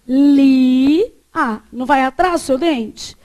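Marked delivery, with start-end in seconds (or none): 0.0-1.1 "Lia" louder, with the tongue behind the teeth; dental l demo. Shown as a demonstration. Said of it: "Fala lia". ("Lia" com a língua atrás dos dentes)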